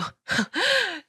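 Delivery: short, high incredulous scoff; scornful laugh. A narrator's reading. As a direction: laugh in bitter disbelief. Bitter scoff amid acted quarrel. (기가 막힌 헛웃음)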